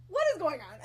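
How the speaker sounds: asked through real laughter; amused disbelief, laughing. Real, not acted. Reader cracks up at the fan fiction, laughing. Laughing at her own story.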